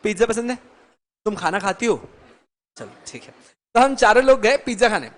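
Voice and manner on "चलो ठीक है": breathy resigned whisper under the breath; whispered aside. Real, not acted. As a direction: let it go in a whisper. Aside after student says no. (फुसफुसाकर, हार मानते हुए)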